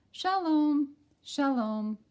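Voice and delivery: high, loud called-out greeting. Acted. Missus Rosen greeting the children, calling high and loud. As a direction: call out a friendly shalom. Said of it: "says Missus Rosen and her children".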